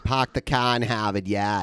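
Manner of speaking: put-on thick Boston accent, dropped Rs. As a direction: say it like a Boston local. Good Will Hunting accent joke.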